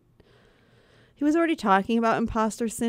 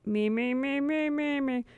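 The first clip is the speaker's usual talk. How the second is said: vocal warm-up; 6 semitones higher than usual. Shown as a demonstration. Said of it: "I wanna be, like, in the zone".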